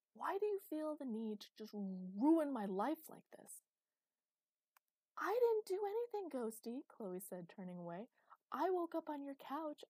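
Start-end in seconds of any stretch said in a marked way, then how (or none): 0.1-3.6 high, loud, drawn-out complaint; exasperated outburst. A narrator's reading. Master blaming Chloe for ruined plans, exasperated. 5.2-6.9 very high, loud denial; indignant protest. A narrator's reading. Chloe denying Master's accusation, indignant. 8.5-9.8 high, loud self-defence; indignant protest. A narrator's reading. Chloe defending herself to Master, indignant.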